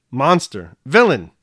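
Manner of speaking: wife screams abuse at her husband; angry shout. A narrator's reading. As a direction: hurl the insults at him. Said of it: "shouted the woman angrily".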